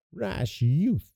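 high, soft mock cry; lightly voiced dwarf crying out. A narrator's reading. Dwarf rushes between fairy and king, crying out lightly. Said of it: "he cried, rushing between".

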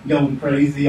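ordinary delivery

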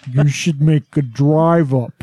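slow, drawn-out dream turtle voice; lightly voiced talking turtle, slowed down. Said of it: "can you do turtle voice again?".

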